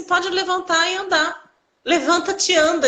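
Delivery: high-pitched mock-biblical pronouncement; joking command. Physiotherapist to patient after stretching knee, joking. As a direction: imitate a playful miracle-worker's order. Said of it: "ele brincou".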